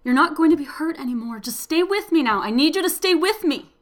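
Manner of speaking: loud, fast desperate pleading; urgent pleading. A narrator's reading. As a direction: beg her to stay, urgently. Pleading with dying woman.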